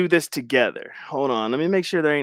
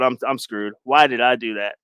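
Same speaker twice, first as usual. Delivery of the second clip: normal talking voice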